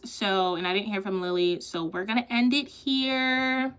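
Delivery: ordinary talk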